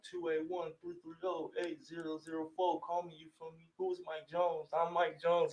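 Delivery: imitating a rapper hyping himself; light hype-man voice. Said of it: "And he was like".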